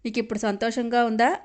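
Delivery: ordinary delivery, nothing marked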